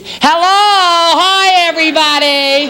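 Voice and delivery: high, drawn-out exercise-show greeting; Richard Simmons. Acted. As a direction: sing out the hello like a gushing fitness host. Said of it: "Richard Simmons".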